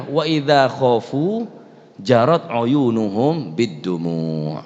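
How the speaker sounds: slow, drawn-out Arabic recitation; preacher slowly chanting the verse to the congregation; quranic recitation. Acted.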